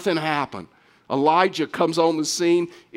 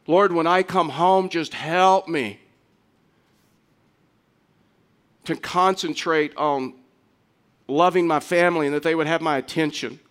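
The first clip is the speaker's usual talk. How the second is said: prayer voice. Shown as a demonstration. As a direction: pray it out as an example to the church. Pastor models a daily prayer.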